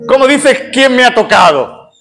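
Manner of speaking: high-pitched, stammering protest; incredulous disciples. Retold or imitated. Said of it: "los discípulos que estaban alrededor dicen". (protesta incrédula, voz aguda)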